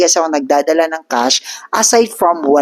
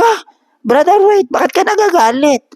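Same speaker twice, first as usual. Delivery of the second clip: much higher, surprised brother, wait; startled placating; 13 semitones higher than usual. Retold or imitated. Narrator taken aback by the angry man, high and startled. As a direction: throw up your hands, startled and placating.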